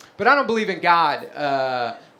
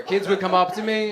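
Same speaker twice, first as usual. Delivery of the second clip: usual speaking voice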